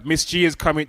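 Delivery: usual speaking voice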